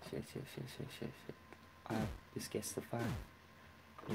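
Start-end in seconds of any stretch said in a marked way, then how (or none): 0.1-1.3 repeated curse, breathed under the breath; frustrated whispered swearing; whispered cursing. Real, not acted. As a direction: hiss it to yourself in frustration. Muttering after messing up.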